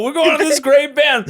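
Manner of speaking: high mock enthusiastic fan voice; mocking excited fan. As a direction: gush about the band in a high voice. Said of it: "have someone go".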